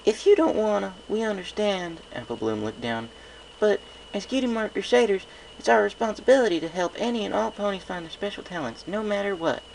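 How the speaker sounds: high little-sister voice, coaxing; a small filly asking big sis a favour; young filly. A narrator's reading. Apple Bloom filly voice.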